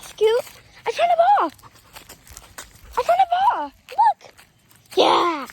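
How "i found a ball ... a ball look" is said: high, bright excited voice for the dog. Real, not acted. Owner showing her dog the ball, high excited.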